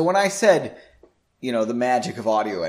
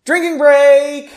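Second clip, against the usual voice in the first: announcing a break; 13 semitones higher, 12 dB louder, slower than usual. Real, not acted.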